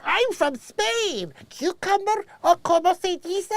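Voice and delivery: high, put-on fake Spanish accent; mocking a fake Spanish accent, drawn out. Mocking Hilaria's fake Spanish accent, high and put-on. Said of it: "With that fake".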